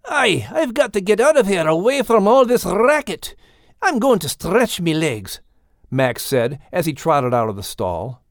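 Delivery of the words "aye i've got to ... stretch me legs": higher, brisk fed-up voice; Max the dog. A narrator's reading. Max escaping the racket in a fed-up voice. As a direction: grumble as you head out.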